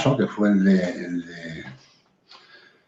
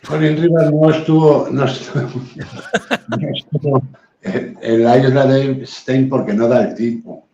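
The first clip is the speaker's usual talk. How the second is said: laughing through the words; higher voice, breaking into chuckles; amused laughing; 4 semitones higher than usual. Real, not acted. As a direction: say it while trying not to laugh. Joking remark, laughs while saying it.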